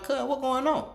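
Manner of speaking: high call across the store. Retold or imitated. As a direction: holler to cousin. Calling across store to cousin.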